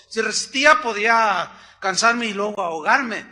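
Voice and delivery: ordinary delivery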